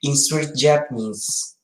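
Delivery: ordinary talk, nothing marked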